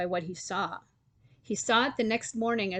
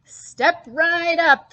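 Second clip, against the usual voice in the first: higher, louder, slow barker call; shouted; 9 semitones higher, 11 dB louder, much slower than usual. A narrator's reading. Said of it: "shouted Willie Withet".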